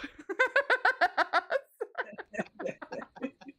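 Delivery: long, high, falling laugh fit; helpless laughter. Real, not acted. Cracking up at her own joke, long laugh fit. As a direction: crack up completely.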